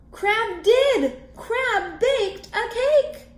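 high, loud delighted cry; thrilled fish cheering; excited character. Acted.

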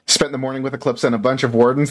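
ordinary talk, nothing marked

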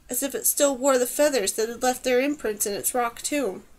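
ordinary delivery, nothing marked

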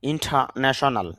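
normal talking voice

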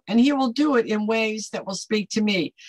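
ordinary talk

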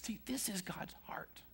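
hushed, intimate whisper. Real, not acted. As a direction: lean in and whisper the key point. Intimate point of sermon.

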